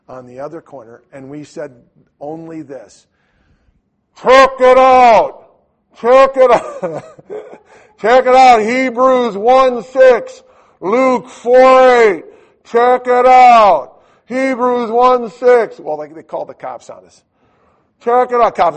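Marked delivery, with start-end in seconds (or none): 4.2-15.8 booming slow megaphone call through a loudhailer; megaphone shouting. Retold or imitated. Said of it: "one thousand yard megaphones". 18.0-18.8 loud high megaphone call at the police; megaphone shout. Retold or imitated.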